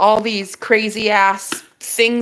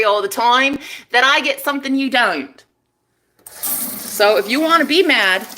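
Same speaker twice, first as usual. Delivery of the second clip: defiant taunting; 5 semitones higher than usual. Real, not acted. Woman telling off her haters, defiant taunting.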